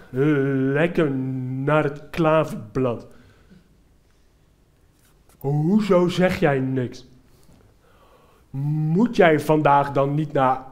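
slow, drawn-out friendly voice; lightly voiced young man, cheerful and very slow; cyclist with Down syndrome. Boy on the bike chats to the comedian, slow and cheery. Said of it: "Ik zeg, Pardon?".

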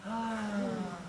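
long, high sustained "ahh"; long exhaled sigh. Shown as a demonstration. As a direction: let a deep breath out on a drawn-out sigh. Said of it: "Take a deep breath".

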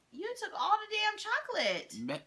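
high-pitched outraged complaint; indignant protest. Real, not acted. Taster accuses partner of hogging the filling, indignant. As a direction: protest the stolen share, voice jumping high.

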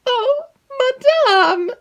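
very high, slow, tearful wail; frightened maid begging her mistress; crying out. Acted. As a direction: plead in alarm, voice cracking. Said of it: "cried Annette".

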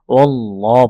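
"ওয়াল্লাহ" slow, with a heavy, rounded l; thick lam. Shown as a demonstration. Teacher explaining the lam rule, "ওয়াল্লাহ" with heavy lam. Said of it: "আল্লাহ শব্দের লামগুলো মোটা হয়".

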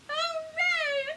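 high, loud hooray; joyful shouted hooray. Acted. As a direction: cheer it out for the kids. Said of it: "do all three".

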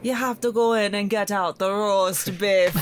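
put-on Russian accent gone Jamaican. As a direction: imitate a Russian boss, sounding Jamaican instead. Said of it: "That did sound Jamaican".

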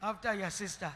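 ordinary talk

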